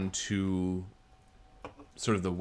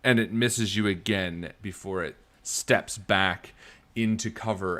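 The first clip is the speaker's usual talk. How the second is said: ordinary delivery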